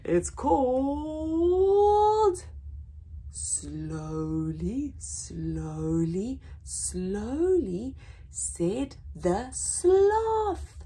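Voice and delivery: stretched, slow drawn-out title; lingering storybook title; slow title read. Acted. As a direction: savour each slowly. Reading title slowly.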